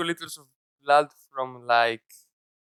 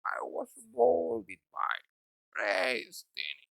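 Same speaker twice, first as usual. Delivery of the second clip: mock brooding villain; 4 semitones higher, 8 dB quieter, much slower than usual. Repeating the villain speech, very slow and fading. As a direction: drag it out and let it die away. Continuing the darkness bit.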